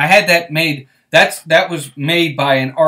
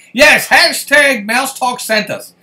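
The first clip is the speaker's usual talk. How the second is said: higher, louder and slow, savouring each word; drawn-out delighted hype; excited cheer; 9 semitones higher, 6 dB louder, slower than usual. Real, not acted. Host cheering viewers to spread the hashtag, excited.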